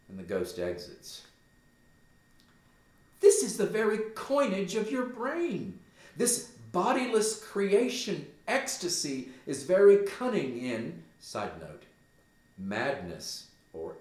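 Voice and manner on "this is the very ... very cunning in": much higher and louder, sure he's imagining it; Gertrude dismissive. Acted. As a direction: tell him it's all in his head. Gertrude dismisses Hamlet as mad.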